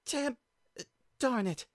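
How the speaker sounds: high pained cursing; stubbed toe. Acted. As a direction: curse through toe pain. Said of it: "She grabbed her foot".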